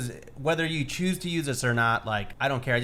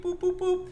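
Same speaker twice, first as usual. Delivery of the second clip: high beeping noises. Retold or imitated. Imitating accountant's computer work.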